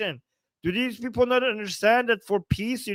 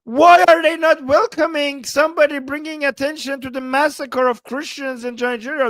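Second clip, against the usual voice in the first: higher, louder exasperated protest; indignant outburst; 4 semitones higher, 5 dB louder than usual. Real, not acted. Streamer cuts off the news commentator, loud and indignant. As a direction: burst in loudly, demanding an answer.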